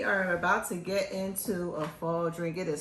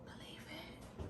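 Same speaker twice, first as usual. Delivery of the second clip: conspiratorial whisper; 2 semitones lower, 11 dB quieter than usual. Real, not acted. Host whispering a joke aside to the viewers. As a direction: let them in on a secret, whispering.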